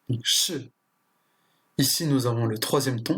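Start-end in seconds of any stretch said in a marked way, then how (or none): 0.2-0.7 che said with a sharp falling tone; che, falling fourth tone of Mandarin. Shown as a demonstration. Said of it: "le quatrième ton".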